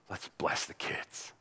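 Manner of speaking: gentle whispered invitation. Acted. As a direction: hush it like a secret to the children. Jesus voiced hushing for children.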